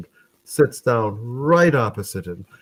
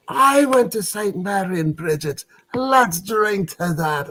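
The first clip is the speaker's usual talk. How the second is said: much higher, excited echo with a slow toast; drunken amazement; 10 semitones higher than usual. Acted. Drunk drinker hears they share a school, amazed and delighted. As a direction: cry it out high, astonished at the coincidence. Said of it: "had his share of the drink".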